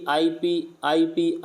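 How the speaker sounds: IP repeated clearly, each ending stressed; IP said slowly and firmly for the class; stressing the ending. Shown as a demonstration. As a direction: drill the ending IP like a teacher. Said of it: "last में देखो IP".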